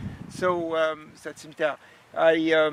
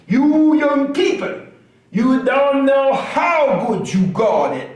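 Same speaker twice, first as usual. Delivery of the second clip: slow, higher, louder scolding; grumpy old man lecture; 9 semitones higher, 13 dB louder, slower than usual. Acted. Old man scolding the young audience, slow and loud. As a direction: wag a finger at the kids.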